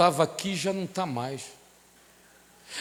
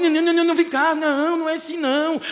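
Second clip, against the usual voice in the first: put-on higher, sing-song coaxing as if to a toddler; mock coddling baby talk; 7 semitones higher than usual. Preacher mocking soothing an offended believer, high sing-song. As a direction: coo at a touchy adult like a baby. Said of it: "levar mamadeira gospel, tem que levar chupetinha gospel".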